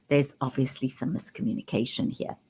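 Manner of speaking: low, quiet dig on "obviously"; quietly loaded, with "obviously" implying blame; pointed remark. Shown as a demonstration. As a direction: drop the voice and slip in "obviously" as a reproach. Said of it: "because there's a big difference between".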